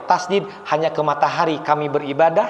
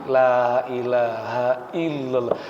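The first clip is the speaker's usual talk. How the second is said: very slow, lower la ilaha illallah; slow talqin; 5 semitones lower, 3 dB quieter, much slower than usual. Shown as a demonstration. Preacher showing an unhurried talqin, very slow. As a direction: guide the dying gently, word by word. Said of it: "Ulang lagi".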